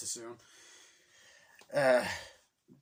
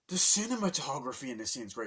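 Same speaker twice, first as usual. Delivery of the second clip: usual speaking voice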